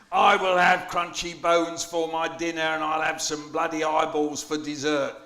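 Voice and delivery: put-on children hear the Cyclops demand his dinner; booming Cyclops. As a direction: roar it out like a greedy giant. Poet voices the Cyclops again.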